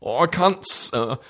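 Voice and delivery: very high mock British stammer; British stutter. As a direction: choke on words in a hammed-up British accent. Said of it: "Even when he stutters".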